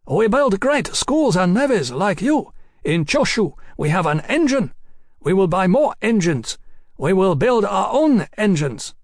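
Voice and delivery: high, swooping, fervent foreign envoy; young Japanese envoy, fired up. A narrator's reading. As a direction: proclaim it in a high, idealistic young voice.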